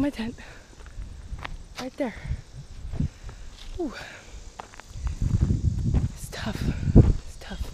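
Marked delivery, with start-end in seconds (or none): 3.8-6.6 gasping between phrases; breathless. Real, not acted. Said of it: "didn't expect it to be this hard". 7.0-7.7 whispered, out of breath; panting the words out; gasping between phrases. Real, not acted. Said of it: "didn't expect it to be this hard".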